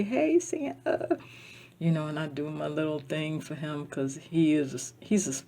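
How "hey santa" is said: high, slow sing-song call; greeting Santa. Retold or imitated. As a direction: stretch out a fond high hello to Santa. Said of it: "I say".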